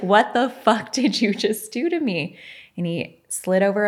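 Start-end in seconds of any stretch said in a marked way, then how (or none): 0.0-2.4 higher, louder mock disbelief; stunned outburst. Client to therapist after anger vanished, stunned outburst. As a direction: retell it as an astonished outburst.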